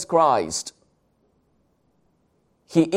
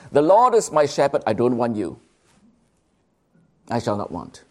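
mock wrong reading; 3 semitones lower than usual. Shown as a demonstration. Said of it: "do not mistake it as".

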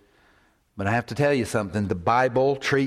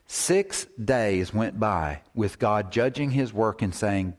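ordinary talk

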